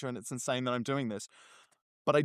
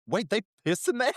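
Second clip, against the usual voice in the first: surprised; 6 semitones higher, 5 dB louder than usual. Retold or imitated. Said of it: "someone walking past the bathroom, going".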